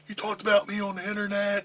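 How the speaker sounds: mock Bradshaw. Repeating Bradshaw's words to the host, a lightly mocking put-on voice. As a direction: mimic the bully's accusation. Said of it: "He goes".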